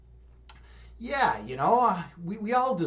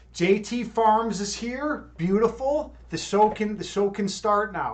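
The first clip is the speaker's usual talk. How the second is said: loud, higher-pitched delighted greeting; excited welcome; 7 semitones higher, 7 dB louder than usual. Real, not acted. Streamer spots a regular viewer in chat, excited welcome. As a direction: announce the arrival with a burst of excitement.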